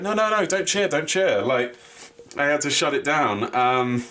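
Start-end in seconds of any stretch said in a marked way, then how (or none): 0.0-1.4 raised-pitch mock plea to the crowd; lightly voiced wrestler telling fans to stop; mock scolding. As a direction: hush the crowd, mock urgent. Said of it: "he had to be like".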